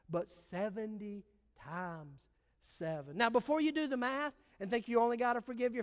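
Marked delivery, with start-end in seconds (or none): 0.1-3.2 quiet, slow Jesus. Acted.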